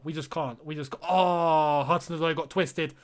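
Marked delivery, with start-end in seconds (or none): 0.0-0.9 close to tears. Real, not acted. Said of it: "I'm not crying, by the way".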